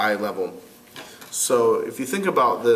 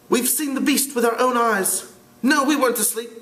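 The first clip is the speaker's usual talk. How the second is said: put-on higher, earnest scared boy; young boy voice; 12 semitones higher than usual. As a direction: insist like a frightened twin. Twins voiced in read story.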